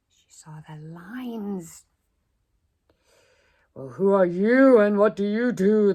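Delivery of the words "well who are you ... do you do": loud roaring lion voice. Acted. Lions roaring at the cow, loud. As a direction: roar the question at her. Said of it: "the lions roared".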